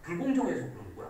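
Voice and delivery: fast, soft rushed excuse; fast self-justifying mutter, lightly acted; quick mock excuse. Shown as a demonstration. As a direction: rattle off the excuse quickly to cover it up. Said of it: "그냥 이렇게".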